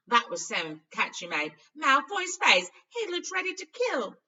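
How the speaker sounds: loud, rising, delighted retelling; gleeful Ron. A narrator's reading. Ron gloating about Malfoy to Harry, loudly gleeful. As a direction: relish the rival's face.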